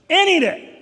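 loud emphasis. Acted. Voicing the enemy's temptation.

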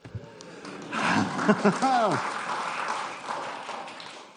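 a long, spent laugh after the rush; worn-out laughter after the reading; breathless laugh. Real, not acted.

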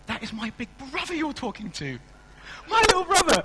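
put-on high, loud boyish defiance; kid brother defiant. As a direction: stand up to the bullies as the little brother. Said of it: "and he goes".

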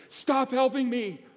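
high, exasperated mock plea; a tired master stopping a clumsy helper; imagined Jesus. Jesus telling Peter to quit helping, high and exasperated. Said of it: "I can almost hear Jesus say".

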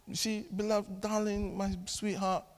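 soft, gentle parental wheedling; hushed, affectionate cajoling; sweet coaxing. Acted. Father coaxing his child sweetly.

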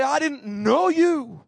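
God's stern rebuke. Acted. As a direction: thunder it out as God passing judgement. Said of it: "he'll say".

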